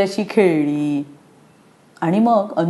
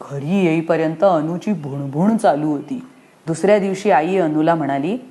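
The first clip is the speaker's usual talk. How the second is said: ordinary delivery, nothing marked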